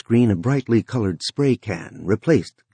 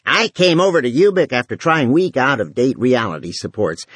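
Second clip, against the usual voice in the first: brassy voice; 6 semitones higher than usual. Acted. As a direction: bellow it like a TV housewife. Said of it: "In a brassy voice, she bellowed".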